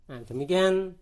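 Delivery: ordinary talk, nothing marked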